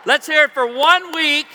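loud, high emcee call over applause; rally the crowd, projecting big; rousing announcement. Real, not acted.

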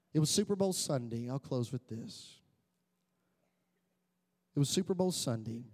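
ordinary talk, nothing marked

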